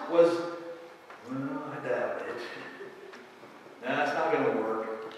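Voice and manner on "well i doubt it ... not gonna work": low, quiet, dismissive doubter; imitating a doubter, low and unconvinced; doubting Thomas. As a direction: mutter it like a skeptic. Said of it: "Doubts everything".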